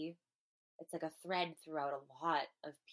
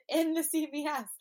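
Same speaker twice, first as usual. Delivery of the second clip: laughing; 10 semitones higher, 13 dB louder than usual. Real, not acted. Laughing at her own tears.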